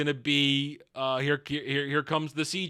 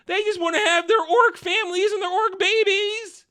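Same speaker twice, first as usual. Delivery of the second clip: high, whiny mock sob story; mock whining; 12 semitones higher than usual. Ranter mocking the sympathetic orc family idea, high-pitched whine. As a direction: plead it in an exaggerated high wail.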